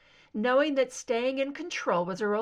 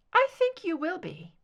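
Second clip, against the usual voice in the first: raised, cool soprano; 8 semitones higher, 3 dB louder than usual. Acted. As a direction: say it knowingly, lifted.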